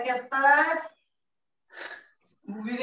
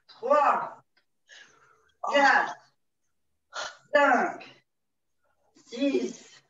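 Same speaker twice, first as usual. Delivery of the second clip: counting workout reps; much slower than usual. Acted. Instructor counting held exercise seconds.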